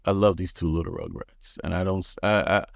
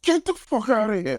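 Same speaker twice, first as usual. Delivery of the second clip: shocked disbelief; 13 semitones higher than usual. Retold or imitated. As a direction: blurt it out stunned.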